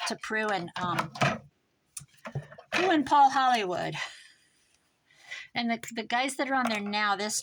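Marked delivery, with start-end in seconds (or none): 2.7-4.0 loud, high, excited announcement. Real, not acted. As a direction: proclaim the names with excitement. Excited naming of show hosts.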